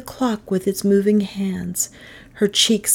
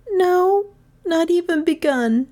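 high schoolgirl answer, not a question; pupil admitting; 12 semitones higher than usual. Acted. Gentian answers the teacher before the class, admitting.

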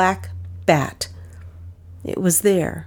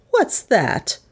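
a little higher, startled question; 5 semitones higher than usual. Acted. Miss Cornelia startled by a noise, asking the room. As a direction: catch a sound and ask. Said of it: "she said in a startled voice".

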